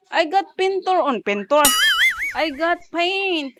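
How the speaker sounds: high, loud, stretched-out announcement; exaggerated baby talk. Showing the baby the paint, loud high-pitched play voice. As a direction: call it out high and draw out the end.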